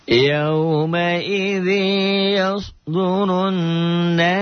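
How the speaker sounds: slow, high chanted tilawah; Quran recitation. Shown as a demonstration. Teacher reciting the verse for the class, slow chant. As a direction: draw the words out melodically.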